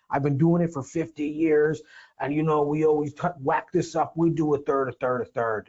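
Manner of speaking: lightly voiced crusty old attorney; a hint of an old lawyer, set in his ways; old man voice. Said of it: "I'm kind of doing my old man voice".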